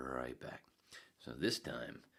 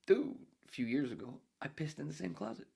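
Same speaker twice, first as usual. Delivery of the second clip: relative's voice; 12 semitones higher than usual. Retold or imitated. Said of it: "And then they said".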